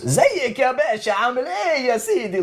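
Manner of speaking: high, fast, lively Egyptian Arabic; imitating an excited Egyptian greeting; Egyptian Arabic impression. Friend imitating Egyptians for a mate, Egyptian Arabic voice. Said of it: "they're very, they're American".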